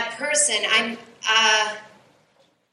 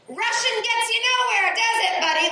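smug taunt; 9 semitones higher than usual. Retold or imitated. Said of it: "I pulled up and went".

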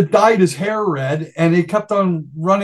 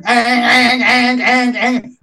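higher voice mimicking a repeating echo; imitated guitar echo; 6 semitones higher, slower than usual. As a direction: sing out the echoing guitar notes, ang ang. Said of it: "Brian's guitar is echoing".

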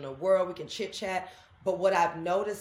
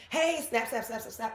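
mock call-out; 5 semitones higher than usual. Stranger with camera calling out.

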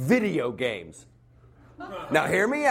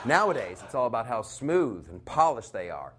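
ordinary talk

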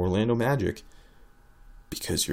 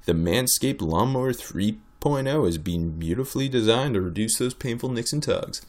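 ordinary delivery

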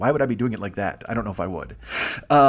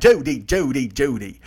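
a loose put-on old-film star voice; Cary Grant; slower than usual. Drifts into Cary Grant, loose old-film star voice. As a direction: play a classic film star calling out. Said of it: "that's more of a Cary Grant".

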